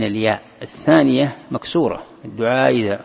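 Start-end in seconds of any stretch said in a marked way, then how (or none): 2.2-3.0 "الدعاء إذا" with the second hamza shaded into a y; touch of ya on hamza. Shown as a demonstration. Teacher illustrating the eased reading, "إذا" begun between hamza and ya. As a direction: give the start of "إذا" a little y instead of a full stop. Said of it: "فتعطيها شيء من الياء".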